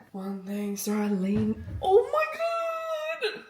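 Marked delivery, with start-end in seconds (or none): 1.8-3.4 high panicked shriek of fright; startled scream. Real, not acted. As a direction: scream out in real alarm. Something started leaning, she screams.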